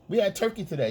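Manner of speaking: high, casual chatter; breezy high mock wife; girlfriend voice. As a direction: toss it off lightly in a high voice. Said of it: "she gave you some sandwich".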